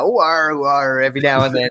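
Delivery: a light mock Cornish burr; a hint of a West Country arr; Cornish accent. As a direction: friends slipping into a Cornish accent on air. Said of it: "slip back into South East London".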